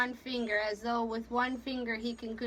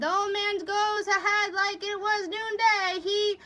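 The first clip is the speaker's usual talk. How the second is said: raised, high-pitched sailor's voice; exclaiming; 9 semitones higher, 5 dB louder than usual. A narrator's reading. Sailor remarking on the captain's boldness, raised voice. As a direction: call it out over the deck.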